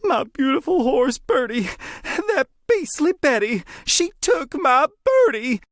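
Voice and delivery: put-on high, wailing young woman mourning her stolen horse; a tearful farm girl whose horse was taken, high and slow at the end; distressed young lady. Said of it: "the sound of someone crying".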